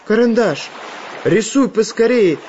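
high, urgent little voice; pleading Chizhik. A narrator's reading. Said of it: "просил Чижик".

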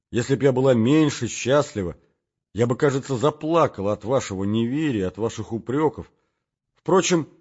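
put-on loud, high young woman's voice; breathless girl, happy and anxious; Nastenka, excited. Настенька взволнованно говорит. (звонкий, взволнованный голос Настеньки)

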